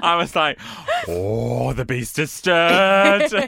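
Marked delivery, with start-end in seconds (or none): 1.1-3.5 slow, louder, rising mock-epic line; hammed-up epic announcement; mock dramatic narrator. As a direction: ham it up like a nature documentary.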